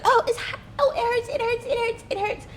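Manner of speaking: high, whiny cries of pain; hammed-up hurting voice; mock dramatic pain. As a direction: ham up her pain, way up high. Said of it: "she's a very dramatic person".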